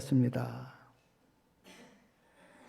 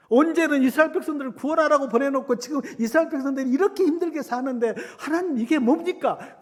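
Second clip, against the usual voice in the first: loud, high, rushed protest, slowing at the end; Moses' indignant complaint; 9 semitones higher, 6 dB louder than usual. Retold or imitated. Moses blaming God for the people's hardship, indignantly. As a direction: pour out the grievance to God. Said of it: "그렇게 모세도 하나님을 원망하지".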